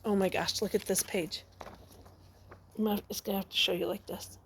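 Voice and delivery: normal talking voice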